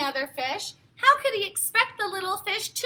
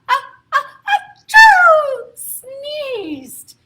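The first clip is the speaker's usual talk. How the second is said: building uh-uh into a loud high achoo; acted sneeze; 8 semitones higher, 13 dB louder, much slower than usual. A narrator's reading. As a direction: act out a big sneeze. Big Al sneezes in story.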